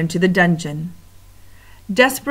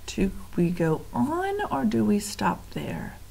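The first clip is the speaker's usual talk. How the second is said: whisper; 3 semitones higher, 7 dB quieter than usual. Real, not acted. Teacher muses to class.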